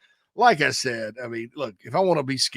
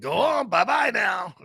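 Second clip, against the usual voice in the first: Pacino impression; 6 semitones higher than usual. Quoting Pacino subway scene.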